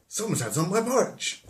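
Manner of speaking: very high, mock-shocked bear voice; Mummy Bear. Mummy Bear finding her porridge eaten, high hammed-up alarm. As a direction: squawk it high in hammed-up dismay. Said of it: "and Mummy Bear's like".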